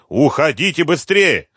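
high, loud urgent order; old man's shout. A narrator's reading. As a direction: shout to hurry your people off. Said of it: "кричал старик". (громкий крик, торопя)

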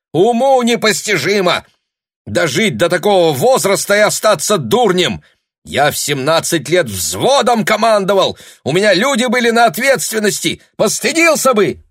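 high, loud furious yelling; angry shout. Acted. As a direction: scold him at full volume. Father scolds son, storms out.

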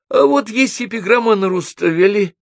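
very high little squeak. A narrator's reading. Гнедич offers another epigram, squeaking. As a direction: pipe up in a squeaky voice. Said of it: "пискнул Гнедич".

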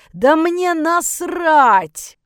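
furious drawn-out roar; yelling. Acted. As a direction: bellow it high and loud, stretching the words. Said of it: "заорал я". (яростный крик, во всё горло)

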